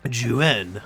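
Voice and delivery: put-on "Juen" as one accented word, joo-EN; mimicked mispronunciation. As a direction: echo the actor's "Juen", low and soft. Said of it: "it sounds like he's saying Juen".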